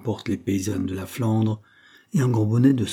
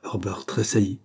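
plain reading voice

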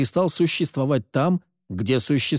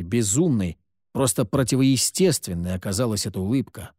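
ordinary delivery, nothing marked

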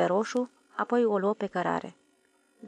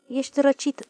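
convinced; 6 semitones higher, 4 dB louder than usual. A narrator's reading. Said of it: "spuse convins domnul Fotiade".

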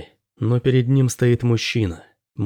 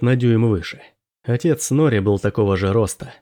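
ordinary delivery

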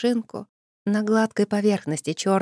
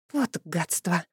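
muttered curse; 4 dB quieter, slower than usual. Acted. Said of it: "выругалась я".

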